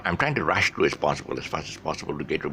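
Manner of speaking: ordinary talk, nothing marked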